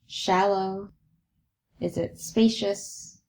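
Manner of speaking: slightly soft, spacious meditation voice; guided meditation. Acted. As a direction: guide their attention to the breath, leaving long pauses. Leads a breathing meditation.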